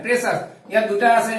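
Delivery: normal talking voice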